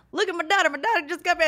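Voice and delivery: high-pitched dad announcing his daughter; teasing dad. Retold or imitated. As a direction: imitate a dad showing off to embarrass. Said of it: "So my dad will be like".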